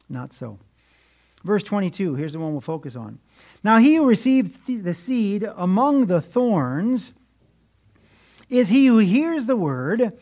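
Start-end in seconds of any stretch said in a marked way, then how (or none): 3.6-10.2 loud, emphatic Bible reading; reading scripture out with force. Acted. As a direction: proclaim the verse to the congregation. Reads scripture aloud from the pulpit.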